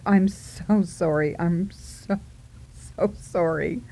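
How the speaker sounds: slow, low, sheepish apology; retold apology. Apologizing to the stranger in the car, sheepish. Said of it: "I'm apologizing".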